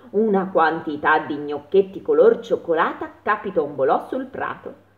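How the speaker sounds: ordinary delivery, nothing marked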